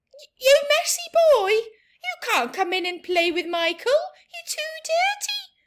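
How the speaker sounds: high, loud, fast scolding with a laugh; mother scolding a filthy boy at the door. Acted. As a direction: shoo away the messy stranger child. Mother scolding unknown child.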